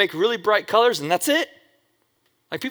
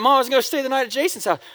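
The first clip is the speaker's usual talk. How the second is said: put-on higher, fast whiny kid; pleading kid; 8 semitones higher, faster than usual. The son pleading with his mom, fast higher whine. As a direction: rush it like a kid begging.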